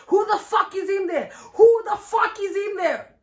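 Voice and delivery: high, loud mock outrage; imitating her loud, high-pitched demand; retold angry yell. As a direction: yell it like a furious girlfriend in a retelling. Girlfriend yelling from outside, retold.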